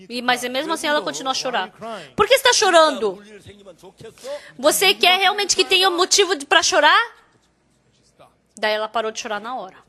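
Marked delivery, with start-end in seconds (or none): 2.1-7.2 mais alto e agudo, repreendendo com força; repreensão firme. Retold or imitated. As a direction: sacuda a esposa para parar de chorar. Pastor repreende a esposa chorando. (pastor repreende a esposa que chora, em voz alta)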